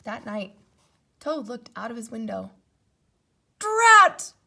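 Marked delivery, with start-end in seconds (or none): 3.6-4.3 high, loud exclamation; exasperated. A narrator's reading. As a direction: curse the stubborn seeds. Toad frustrated exclamation.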